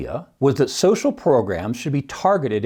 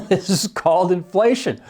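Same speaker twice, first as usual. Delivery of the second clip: said through a laugh, pitched up; 6 semitones higher than usual. Real, not acted. Economics podcaster scoffing at a rosy claim, laughing. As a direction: laugh as you say it, amused.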